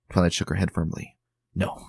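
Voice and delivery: ordinary delivery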